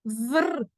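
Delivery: "vr" buzzed and rolled together; isolated cluster sound. Shown as a demonstration. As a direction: sound out "vr" without a full word. Teacher sounds out consonant cluster.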